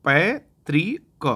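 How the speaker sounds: पैतृक stretched slowly with clear त्र; slow careful pronunciation. Shown as a demonstration. Teacher showing students the correct पैतृक, slowly. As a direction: draw out पैतृक slowly for the class. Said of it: "इस तरीके से ये होता है".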